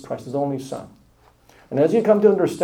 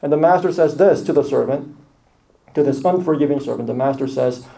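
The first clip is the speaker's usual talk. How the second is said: ordinary talk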